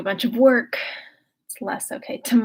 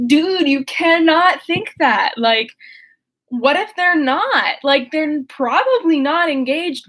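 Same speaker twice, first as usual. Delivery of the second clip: exasperated Eleanor; 6 semitones higher, 6 dB louder, slower than usual. Retold or imitated. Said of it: "And Eleanor's like".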